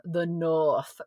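slow imitation of a northern English accent; imitated northern accent. Quoting the British family's origins, imitated northern English accent. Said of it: "That's what they say".

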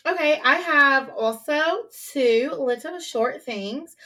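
slow, drawn-out attempt at an Irish accent. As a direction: try an Irish accent, slowly. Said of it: "I was trying to do, like, an Irish accent there".

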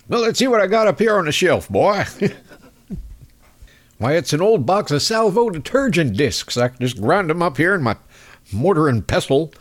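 put-on a mild southern country-doctor accent. Host plays the Kentucky doctor again, southern accent. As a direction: rummage the shelf like a folksy southern doctor. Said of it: "that southern accent".

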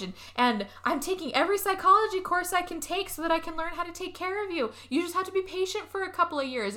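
high-pitched acted bickering; mock arguing. Two girls arguing over living together, mock bickering. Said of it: "they argue for a, a good minute".

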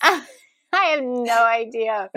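usual speaking voice

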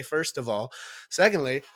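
ordinary talk, nothing marked

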